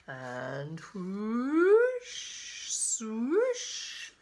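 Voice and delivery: long whoosh sliding steeply upward; swooshing storybook sound effect. Acted. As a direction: draw out the sound effect with a rising swoop. Acting out the crab's slip.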